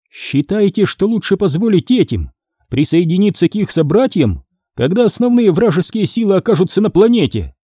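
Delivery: melodic voice. Acted. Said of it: "раздался мелодичный голос".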